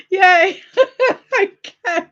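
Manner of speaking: high, loud burst of relieved giggles; laughing. Real, not acted. Relieved laughing after mic fixed.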